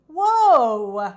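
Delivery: very loud startled whoa; crying out. Acted. Kate reining in her jumping horse, loud startled shout. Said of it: "cried Cowgirl Kate".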